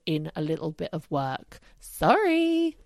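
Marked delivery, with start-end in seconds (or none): 1.8-2.8 high, cheeky sorry; mock apology. Real, not acted. Mock apology after scolding.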